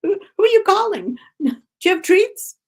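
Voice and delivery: high, loud eager dog voices; confused dogs. Pretend. All the neighborhood dogs answering the call, high eager voices. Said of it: "Everybody's dogs are like".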